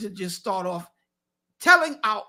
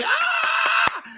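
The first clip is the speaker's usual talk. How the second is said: high, drawn-out hype cheer; cheering scream; 4 dB louder than usual. Real, not acted. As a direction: whoop the guest onto the stage. Host hypes applause for guest.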